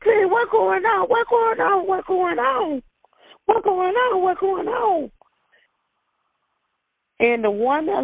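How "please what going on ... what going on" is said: high, near-crying begging; tearful pleading. Retold or imitated. Mother pleading with the officer over her baby, near tears. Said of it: "I said".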